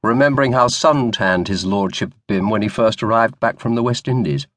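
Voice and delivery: ordinary delivery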